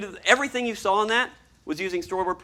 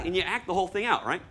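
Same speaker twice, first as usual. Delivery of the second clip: usual speaking voice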